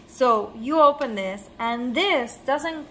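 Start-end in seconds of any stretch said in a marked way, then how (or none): none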